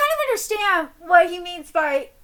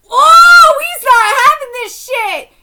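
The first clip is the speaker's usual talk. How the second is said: loud, high, drawn-out reaction; stunned, thrilled shout; hyped outburst; 9 semitones higher, 16 dB louder, slower than usual. Real, not acted. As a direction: yell like the fight just turned. Hyped reaction to angry character.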